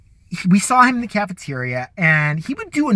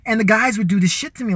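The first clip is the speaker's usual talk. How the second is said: ordinary talk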